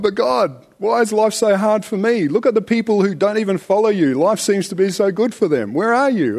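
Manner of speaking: a hammed-up whinge about unfair life; mock whiny complaint. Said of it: "And we're going".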